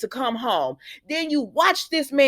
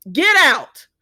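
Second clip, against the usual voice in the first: louder, higher mock order to leave; emphatic order; 5 semitones higher, 6 dB louder than usual. Recapper telling Ridge to leave this woman's house, emphatic louder order. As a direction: throw him out with a forceful shout.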